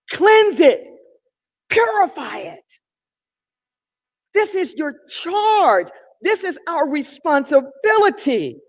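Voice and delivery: rising preacher's cadence, pushing hard; fervent sermon delivery; preaching at full force. Acted. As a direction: preach it to the congregation.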